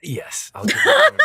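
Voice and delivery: laughing high and loud; cracking up mid-line. Real, not acted. GM granting the player cornbread, laughing.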